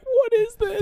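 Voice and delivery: high, loud confused outburst; baffled. Retold or imitated. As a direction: squeal it in confusion. Confused at own burrito.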